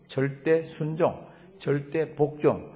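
slow, deliberate, each word weighed; emphatic slow. Acted. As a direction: lay down each word slowly. Pastor drives home the point.

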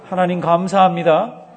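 loud, lifted call for the congregation to echo; loud lead-and-repeat line; call for repetition. Shown as a demonstration. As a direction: proclaim it strongly, rising at the end. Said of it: "저를 한번 따라하십니다".

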